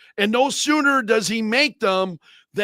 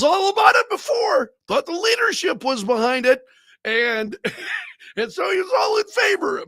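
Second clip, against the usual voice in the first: mocking outrage; 10 semitones higher than usual. Mocking Nadler's flip-flop.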